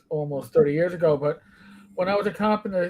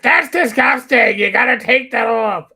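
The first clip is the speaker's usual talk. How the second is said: scolding mother; 6 semitones higher, 7 dB louder than usual. Retold or imitated. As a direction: yell at him to take it down. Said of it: "She's like".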